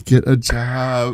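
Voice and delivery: put-on slow drawled party girl line; drunk party girl voice. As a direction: lazily mimic the tipsy party girl. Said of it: "you did it yourself right there".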